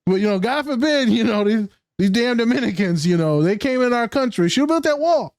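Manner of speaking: high-pitched mock bigot grumble; in-character bigot. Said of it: "You're in character".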